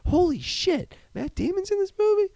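tiny, very high falsetto squeal; mock amazement. Audience spotting the secret star, in squeaky mock amazement. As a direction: imitate astonished chatter in a squeaky falsetto. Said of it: "the whole audience was like".

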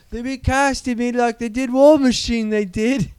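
high, comic protesting voice; Stephen Merchant. Acted. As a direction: explain yourself in a hammed-up high voice. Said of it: "Now you're Stephen Merchant".

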